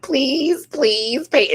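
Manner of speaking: high, loud mock begging; hammed-up desperate plea; pleading. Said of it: "I'm like".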